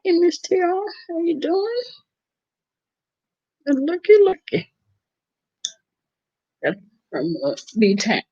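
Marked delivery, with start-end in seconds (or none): 0.0-1.8 excited greeting. Real, not acted. Greeting a viewer who joined. 3.7-4.7 playful looky looky. Real, not acted. Greeting a viewer who dropped in.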